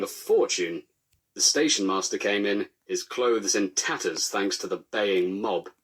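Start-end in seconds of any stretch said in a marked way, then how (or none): none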